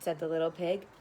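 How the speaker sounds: usual speaking voice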